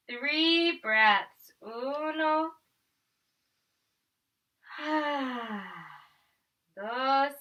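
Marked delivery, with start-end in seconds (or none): 4.7-5.8 sigh. Shown as a demonstration. Breath count in yoga.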